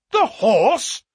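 loud, high incredulous question; astonished cry. A narrator's reading. Colonel and narrator learning the murderer's identity, astonished cry. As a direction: cry it out in disbelief, rising at the end. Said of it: "cried both the Colonel and myself".

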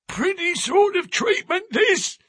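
put-on high, indignant old voice crying out; an affronted old man stamping his stick. A narrator's reading. Old sailor protests to Jones and the narrator, indignant cry. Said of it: "he cried".

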